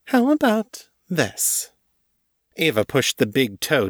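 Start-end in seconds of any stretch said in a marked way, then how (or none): 0.0-1.7 high, playful and taunting; teasing mistress voice. A narrator's reading. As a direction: tease her pet with sweet menace. Ava teasing, high and slow.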